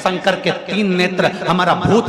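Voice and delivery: loud, driving sermon delivery; full-force preaching. Acted. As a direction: preach it out to the whole crowd.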